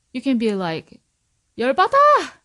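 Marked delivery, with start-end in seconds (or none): 1.6-2.3 high, loud outburst; emotional shout. Shown as a demonstration. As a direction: yell it out with feeling. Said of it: "put emotion in it".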